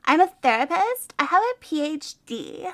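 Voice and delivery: put-on valley girl therapist defending her PhD; offended valley girl. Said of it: "Be like".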